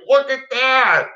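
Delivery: high, drawn-out excited cry; imitated old guy. Old guy to drone pilot as hawk strikes, high excited cry. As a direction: call it out slowly, way up high. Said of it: "the old guy was next to me".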